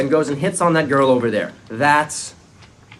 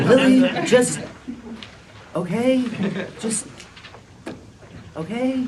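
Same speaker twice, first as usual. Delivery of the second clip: higher, pleading okay; exasperated plea; 10 semitones higher than usual. Acted. Barney begging Lily to stop mocking him, pleading. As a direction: beg her to drop it.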